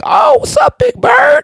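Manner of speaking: put-on toddler greeting goose. Two-year-old daughter taunting the goose in a high shout. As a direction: call it out like a fearless toddler. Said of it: "she was like".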